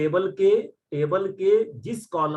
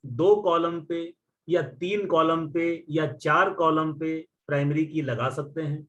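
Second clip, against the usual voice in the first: slow interview question; slower than usual. Retold or imitated. Teacher posing the interviewer's question slowly to students. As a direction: question asked slowly, word by word. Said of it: "सवाल पूछा जाएगा कि".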